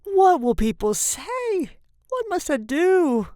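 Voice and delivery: slow, high, loud lament; horrified wail. A narrator's reading. Father dreads what people think, wailing in horror. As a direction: agonise over the disgrace. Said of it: "in an ecstasy of horror".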